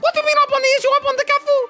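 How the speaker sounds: angry high mom. Acted. Angry mom confronts dad.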